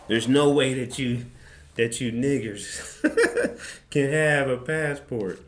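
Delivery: a hint of a mean DMV lady. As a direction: scoff it out like a snide clerk. Mocking the racist DMV lady.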